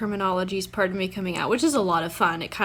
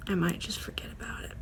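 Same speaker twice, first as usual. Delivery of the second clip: whisper; 3 semitones lower, 8 dB quieter, faster than usual. Real, not acted. Throwaway aside about reward.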